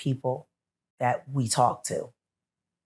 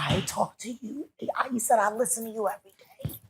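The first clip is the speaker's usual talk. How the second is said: higher, choked-up mock fan voice; 9 semitones higher, 3 dB quieter than usual. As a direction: imitate a fan near tears. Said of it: "he's crying".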